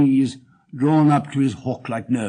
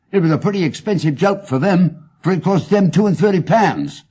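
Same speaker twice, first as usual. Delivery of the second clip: higher, louder voice with a sore grievance; aggrieved client; 5 semitones higher, 5 dB louder than usual. Acted. Wilson insists to Holmes that he was wronged, aggrieved. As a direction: protest that you were played for a fool. Wilson protests his loss.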